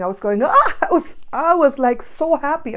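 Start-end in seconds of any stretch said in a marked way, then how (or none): 0.4-0.8 a high, loud gasp of delight; excited gasp. Retold or imitated. Recalling meeting Gandalf, a high loud gasp. 0.8-2.7 excited. Retold or imitated. Recalling her joy.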